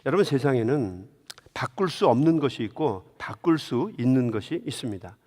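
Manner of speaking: usual speaking voice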